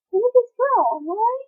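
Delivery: high-pitched baffled onlooker; high put-on disbelief; mock bafflement. Imagines baffled audience reaction.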